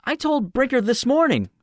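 very high, strained and unsteady; shaking voice. A narrator's reading. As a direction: raise the pitch high, as if barely in control. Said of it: "in a voice treacherously shaking".

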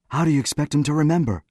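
quick, high, sharp retort. A narrator's reading. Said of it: "said Finney sharply".

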